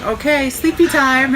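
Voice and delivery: high, slow, sing-song "sleepy time"; soothing nurse voice. Retold or imitated. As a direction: coax a patient off to sleep. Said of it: "and her saying".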